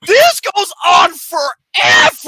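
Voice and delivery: high, loud and slow complaint; loud incredulous wail; exasperated shout. Real, not acted. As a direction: drag it out in real exasperation. Exasperated at endless moaning scene.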